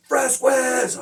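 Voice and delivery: high, loud name call; mail-call shout. Retold or imitated. Guard calling an inmate for mail, shouted. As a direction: call the surname out across the cell block.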